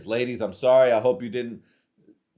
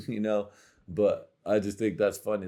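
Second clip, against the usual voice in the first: ordinary talk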